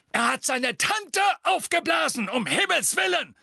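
high indignant shout. A narrator's reading. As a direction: shout it in outrage. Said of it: "rief Fudge".

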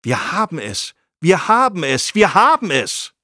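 elated, escalating exclamation; voice rising excitedly. A narrator's reading. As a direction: build from normal pitch to high, loud triumph. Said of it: "Seine Stimme wurde immer höher".

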